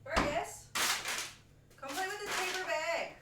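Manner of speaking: high-pitched call to a pet; coaxing a cat. Real, not acted. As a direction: lure the cat over in a high voice. Coaxing cat over to play.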